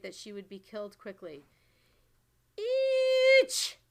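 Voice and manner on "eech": high, loud retching "Eech!" in a strange voice; disgusted yell. A narrator's reading. Giant tasting the foul snozcumber, loud disgusted yell. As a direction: spit out a yell of pure disgust. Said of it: "yelled the blood bottler".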